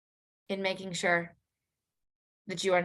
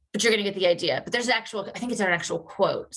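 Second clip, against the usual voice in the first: ordinary delivery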